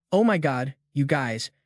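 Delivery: ordinary delivery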